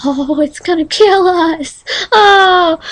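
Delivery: mock terrified whimper. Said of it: "I was like".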